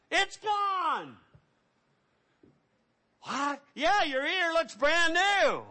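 high, amazed exclamations; astonished at a friend's healed ear; excited. Acted. Leper marvelling at a friend's new ear, high and amazed. Lepers discover healing, acted dialogue.

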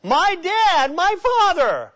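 retold sobbing outcry; weeping cry. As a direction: cry out to him as she breaks. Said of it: "She began to weep loudly".

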